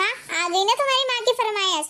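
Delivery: usual speaking voice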